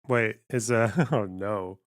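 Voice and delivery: usual speaking voice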